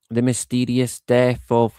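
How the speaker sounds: ordinary talk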